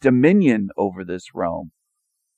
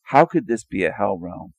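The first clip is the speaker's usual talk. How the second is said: ordinary talk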